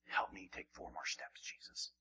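very soft whispered plea; whispered prayer. Acted. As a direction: breathe the prayer. Prayer voiced quietly.

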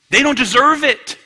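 high, loud refusal; indignant outburst. Acted. Angry person refusing others compassion, loudly indignant. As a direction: throw it out at full indignant force.

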